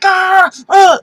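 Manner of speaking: angry yell, loud and high; hammed-up angry outburst; mock angry shout. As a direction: bark it out like an enraged god. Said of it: "like, angry".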